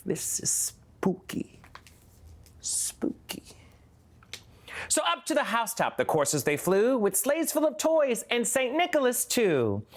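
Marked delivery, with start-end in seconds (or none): 0.1-3.4 slow hushed spooky whisper. Pretend. Mock spooky whisper about Santa on the roof. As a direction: whisper it like a ghost story.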